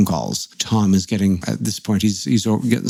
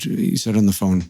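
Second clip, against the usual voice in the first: ordinary talk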